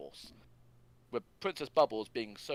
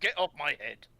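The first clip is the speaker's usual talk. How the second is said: indignant yell; 6 semitones higher, 7 dB louder than usual. Retold or imitated.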